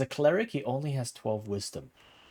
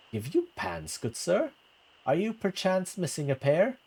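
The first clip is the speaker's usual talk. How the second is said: dry teasing lady; a hint of arch mockery; amused noble lady; 7 semitones higher than usual. Lady mocking.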